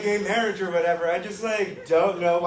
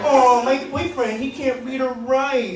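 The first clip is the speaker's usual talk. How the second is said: put-on higher, slow girly voice; mock girlfriend; 7 semitones higher, slower than usual. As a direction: coo like a proud girlfriend. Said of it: "They're like".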